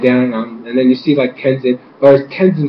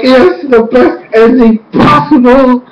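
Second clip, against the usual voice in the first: high, jubilant cheer; so happy; 8 semitones higher, 3 dB louder, slower than usual. Retold or imitated. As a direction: savour the triumph, stretching the words. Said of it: "I was so happy".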